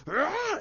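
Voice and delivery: high, harsh "ah" cry; imitated yell. Storyteller as Joker pushing him over the edge, yelling. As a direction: mimic a villain's shriek of effort.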